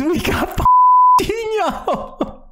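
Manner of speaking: high laughing shriek; laughing squeal. Real, not acted. Streamer telling viewers about Dino, squealing with laughter. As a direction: burst out laughing as you say it. Retelling the hit, cracking up.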